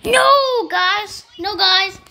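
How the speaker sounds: high loud dismay; excited shout. Real, not acted. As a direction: yell in dismay. Dying in game.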